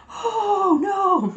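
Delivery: high mock crowd "oh no"; crowd groan. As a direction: imitate a whole store groaning. Whole store reacts to outage.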